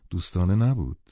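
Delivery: plain reading voice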